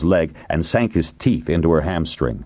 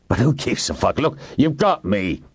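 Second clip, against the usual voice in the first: strained, desperate bargaining; urgent surrender without raising the volume; desperate plea; 6 semitones higher than usual. A narrator's reading. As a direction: plead as a cornered man. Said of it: "Hiram said desperately".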